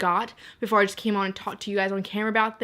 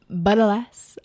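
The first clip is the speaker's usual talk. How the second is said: mock dramatic whisper; 4 dB louder, slower than usual.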